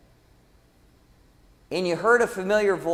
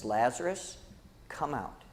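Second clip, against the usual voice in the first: low, hushed quote, much softer; softly acted call; 6 semitones lower, 11 dB quieter than usual. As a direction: say the summons quietly, almost to yourself. Same call repeated for human nature.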